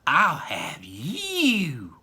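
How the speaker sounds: slow, sly whisper. Acted. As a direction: creep up and whisper it. Veruca sneaks toward the squirrel.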